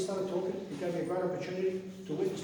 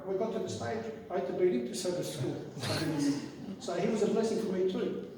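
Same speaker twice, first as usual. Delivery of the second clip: ordinary talk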